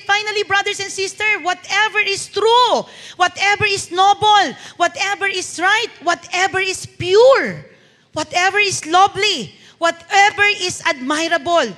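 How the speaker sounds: slow, emphatic scripture reading, each phrase punched; preaching. Acted. Pastor reads Philippians verse eight to the congregation, preaching slowly. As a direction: proclaim each whatever line slowly and strongly.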